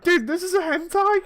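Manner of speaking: high, incredulous question; shocked disbelief. Retold or imitated. Friend on the train, stunned at the blurb, pitched high. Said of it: "and I'm like".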